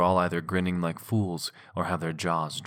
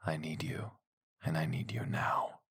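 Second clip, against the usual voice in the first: whispering; 5 dB quieter than usual. Acted. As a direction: breathe it with need. Intimate whispered line.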